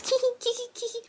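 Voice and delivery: high, soft imitated giggle; kikiing. Tinkerbell giggling over texts, imitated kikiing. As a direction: mimic girlish tittering in tiny high squeaks. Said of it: "sitting there kikiing out loud".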